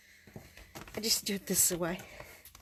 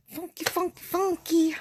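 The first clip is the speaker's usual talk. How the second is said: much higher, bouncing "funky, funky, funky"; playful sing-song; 8 semitones higher, 6 dB louder than usual. Real, not acted. Crafter goofing about the inky smell, high playful sing-song. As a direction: toss the word up high like a silly chant.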